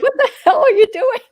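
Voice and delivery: high, fast, loud mock husband yelling; husband's shocked reaction. Imitating her husband seeing her tank top, mock outrage. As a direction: squeal it out as the shocked husband, laughing. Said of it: "He's like".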